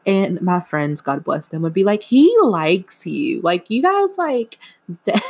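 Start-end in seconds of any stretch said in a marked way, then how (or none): 2.0-5.2 hammed-up squealing friend, jumping much higher on guys; mock friend voice. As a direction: imitate a gushing friend, pitch shooting up. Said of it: "I'm laughing at my own, like, voice".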